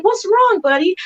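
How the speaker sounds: a tender, high-pitched what's wrong; soothing mom voice. Retold or imitated. Mother soothing her crying son, high and tender. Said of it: "And I'm like".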